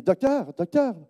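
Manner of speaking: high call to the doctor; retold eager call. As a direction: hail him as he passes. Calling the passing doctor.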